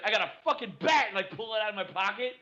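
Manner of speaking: high, fast gleeful reveal; excited boast. Pretend. Showing off his bat to a dog walker, high and fast. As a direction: blurt it out like a proud kid.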